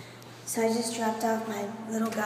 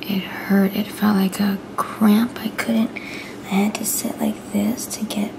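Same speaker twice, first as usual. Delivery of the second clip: whispering; 7 dB louder than usual. Real, not acted. Whispers to camera throughout.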